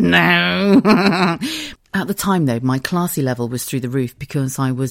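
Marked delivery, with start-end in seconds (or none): 0.0-1.9 loud, high no breaking into a laugh; guilty, giggly denial; sheepish laughing no. Retold or imitated. Caught on a digger by a policeman, giggling no. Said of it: "And I went".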